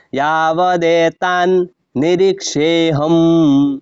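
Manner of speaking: "ইয়াবদ এতান নিরীক্ষেহম" drawn out very slowly, the হ long; slow syllable reading. Shown as a demonstration. Teacher modelling the drawn-out pronunciation. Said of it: "মাত্রা ছাড়া হটা ওর মতো উচ্চারণ হবে".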